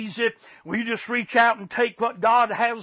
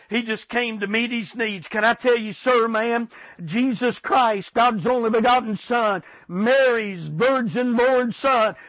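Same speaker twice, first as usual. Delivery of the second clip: normal talking voice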